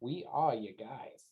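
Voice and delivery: low, slow in-character assurance; low lean-in. Acted. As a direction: lean in and drop the voice low, drawing it out. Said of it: "get a little low".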